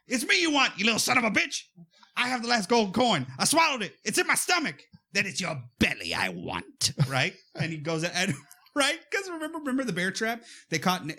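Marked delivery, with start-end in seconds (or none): 0.1-4.8 high-pitched heroic outburst; desperate challenge. Acted. Ozzy offering himself to the leprechaun to save Alex, desperate challenge. 5.1-6.9 low creature villain voice; menacing leprechaun. Acted. Leprechaun answering the one who swallowed his coin, low menace.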